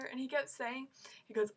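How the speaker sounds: ordinary delivery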